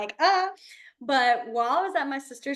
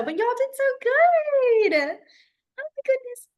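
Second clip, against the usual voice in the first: much higher, slow, gushing praise; giddy praise; 12 semitones higher, 5 dB louder, much slower than usual. Real, not acted. Teacher praising the class after fingerspelling, high and slow. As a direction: beam at the whole class. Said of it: "I get giddy about every little thing".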